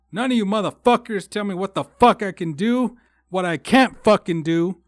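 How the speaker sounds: angry rant. Real, not acted. Vlogger cursing out old hometown people, building to a furious yell. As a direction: yell your resentment at the hometown across the state.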